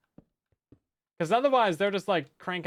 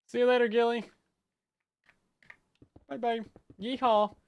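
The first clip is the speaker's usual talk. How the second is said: mock Southern goodbye; 8 semitones higher, 4 dB louder than usual.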